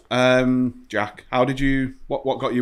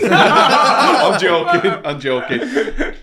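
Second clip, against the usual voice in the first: laughing; 7 semitones higher, 6 dB louder, much slower than usual. Real, not acted. Laughing off a joke.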